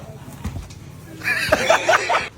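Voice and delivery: high, breathy burst of laughter; real laughing fit, almost silent. Real, not acted. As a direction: crack up helplessly at the joke. Customer cracks up at prize.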